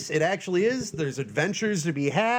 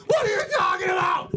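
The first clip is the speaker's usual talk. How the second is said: freaking out voice. Retold or imitated. Host mimics his own freak-out at coworkers, high shriek. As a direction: shriek it like he's losing it. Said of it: "go-to freaking out voice".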